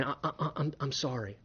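soft, halting, tearful apology; a mourner sorry for crying, softly halting and tearful; imitating tearful apology. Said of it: "as they're crying".

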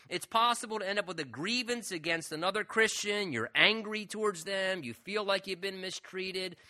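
ordinary talk, nothing marked

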